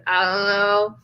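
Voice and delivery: mock-dim, level non-answer; clueless shrug. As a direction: answer like a clueless husband, lightly acted. Acted clueless Othello answering host.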